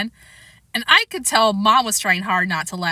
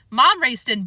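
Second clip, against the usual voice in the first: plain reading voice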